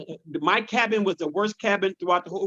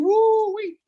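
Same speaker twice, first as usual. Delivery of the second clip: woo-wee jumps high; high exclamation; 13 semitones higher, 4 dB louder than usual. Real, not acted. Exclaiming about hard childhood.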